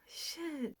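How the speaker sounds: breathy whisper under her breath; whispered curse. Real, not acted. Fan whispers on seeing a misspelled name, a whispered curse. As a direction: hiss it out in shock, no voice.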